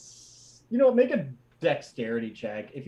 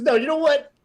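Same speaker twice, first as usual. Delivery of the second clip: indignant outburst; 3 semitones higher than usual. Acted. Horse rebuts the party, offended high rant. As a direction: defend his pride, offended.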